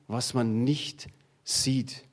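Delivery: ordinary talk, nothing marked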